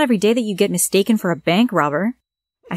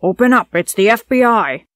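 loud official man at the door; lightly voiced FBI agent shouting through the door; man's voice; 2 semitones higher, 5 dB louder than usual. A narrator's reading. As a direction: bang out the FBI call like a man on the doorstep. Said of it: "said a man's voice".

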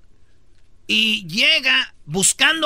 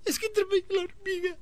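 imitating a crying child; 10 semitones higher, 8 dB quieter than usual. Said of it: "A ver, ¿cómo?".

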